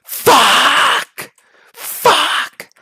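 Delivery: high, drawn-out agonized scream. Acted. Inmate attacked in his sleep, screaming. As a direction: scream the curse long and loud. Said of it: "you're screaming".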